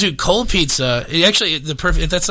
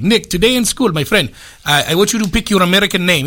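put-on higher-pitched muddled Russian-Indian accent; angry Russian-Indian accent; 4 semitones higher than usual. Tech support guy renames his buddy, angry Russian-Indian accent. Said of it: "it always sounds like angry Russian Indian guy".

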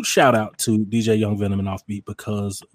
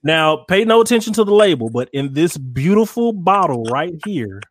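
showman pitch, higher and louder; ringmaster; 6 semitones higher, 5 dB louder than usual. Acted. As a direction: present the bottle like a showman. Presenting the sauce bottle grandly.

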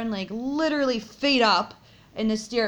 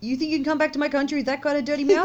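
mock scolding; 6 semitones higher, 4 dB louder than usual. Mock telling off for beets.

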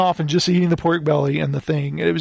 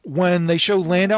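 normal talking voice

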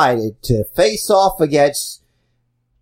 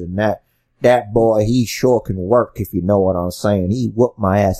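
put-on old-timer reminiscing; 7 semitones lower than usual. Veteran commentator recalling his territory days, lower folksy voice. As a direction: spin a yarn like a grizzled veteran.

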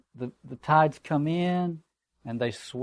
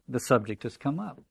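usual speaking voice

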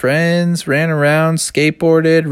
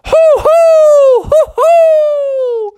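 loud falsetto hoots; long, high hoo-hoo; owl-like whoop; 7 dB louder, much slower than usual. A narrator's reading. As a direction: hoot with glee. Bear whoops in flight.

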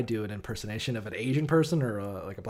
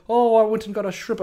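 high, loud put-on British; British accent; 10 semitones higher, 7 dB louder than usual. Coworker telling about his weekend, imitated British accent. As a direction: exaggerate a Downton Abbey British accent, pitched up. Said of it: "Downton Abbey fucking British accent".

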